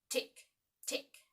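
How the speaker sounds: unvoiced tick, tick; whispered ticking. A narrator's reading. Narrator imitating the crocodile's clock, whispered.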